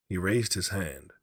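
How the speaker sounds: ordinary delivery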